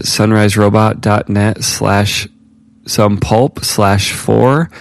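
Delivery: very slow, spelling out a web address; slow and deliberate. Real, not acted.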